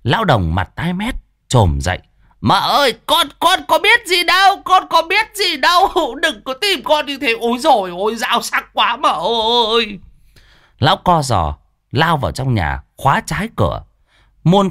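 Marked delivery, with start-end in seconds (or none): 2.4-10.0 put-on much higher, whining old man's plea; a cowering old man pleading with the knife-wielding wife; terrified old man begging. Old man begging the furious wife to spare him, whining. Lão Đồng begs, voiced.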